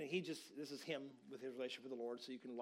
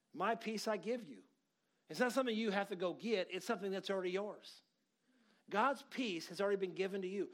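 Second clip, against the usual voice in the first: raised, louder preaching voice; 7 semitones higher, 6 dB louder than usual. Acted. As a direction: preach it up to the room.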